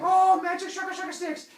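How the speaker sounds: high, loud cry of amazement; excited. Acted. Villagers see fire from sticks, excited cry. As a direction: exclaim it in thrilled awe. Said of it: "they get all excited".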